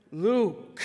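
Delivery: "Luke" articulated slowly and precisely; carefully pronounced Luke. Shown as a demonstration. Pastor teaching the Luke motion, careful precise "Luke". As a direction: stretch out "Luke" with careful pronunciation. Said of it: "anybody wanna do that one with me".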